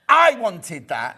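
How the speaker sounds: slow, loud mock demand; retold demand. Shop stranger claiming the item, slow loud mock demand.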